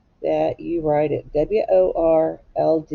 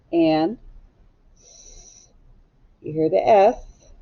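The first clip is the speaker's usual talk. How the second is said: normal talking voice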